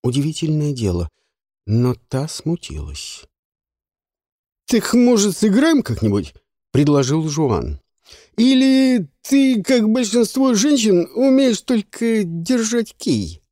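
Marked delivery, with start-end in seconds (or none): 4.6-6.3 high, loud playful challenge; cocky man's voice. Acted. Жуан предлагает сыграть. 8.4-13.4 high, slow drawn-out mockery; mocking taunt. Жуан дразнит женщин.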